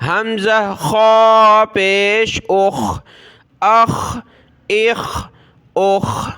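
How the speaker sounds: خا as a full, rounded "khaw"; slow drill, heavy back-of-mouth خا and rasped اخ; thick kha. Shown as a demonstration. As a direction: make خا and اخ thick. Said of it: "خا کو موٹا پڑھنا ہے".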